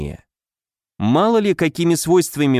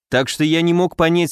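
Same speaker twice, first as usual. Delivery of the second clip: ordinary delivery, nothing marked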